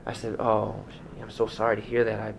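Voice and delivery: a hint of a soft, gentle pastor on the phone; lightly voiced consoling pastor. Retelling a call to a grieving widow, in a slightly soft pastor voice. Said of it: "I put my pastor voice on".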